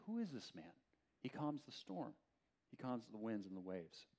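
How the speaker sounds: hushed, wondering question; awed dread. Retold or imitated. Disciples marvelling at the man who calmed the sea, hushed. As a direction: speak softly, as if stunned by fear. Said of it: "they became more terrified".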